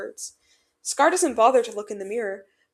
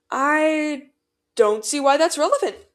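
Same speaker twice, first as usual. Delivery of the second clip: hesitant start, then guarded answer; guarded, pausing after the first word; careful; 5 semitones higher, 6 dB louder, slower than usual. A narrator's reading. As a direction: choose each word carefully, louder and higher. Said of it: "he says carefully".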